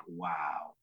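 low, hushed disbelief; stunned realization. Retold or imitated. Actor realizes the director set him up, hushed disbelief. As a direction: drop to a soft, low wow.

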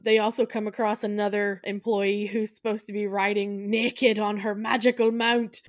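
usual speaking voice

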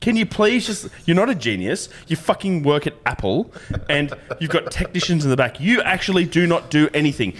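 high, fed-up rant at the staff; exasperated rant. Retold or imitated. Telling the Apple genius he does nothing, exasperated and high. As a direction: vent it, pitched well up.

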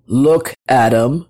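stately, low divine call; God. A narrator's reading. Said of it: "said God".

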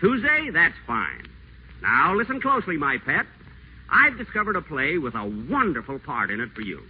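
high, gushing sweet talk; butter her up on the phone; honeyed phone charm. Acted. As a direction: pitch the voice up and coo over the phone line. Whiteside sweet-talks Lorraine on phone.

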